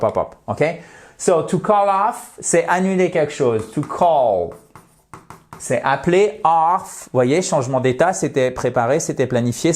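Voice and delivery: ordinary delivery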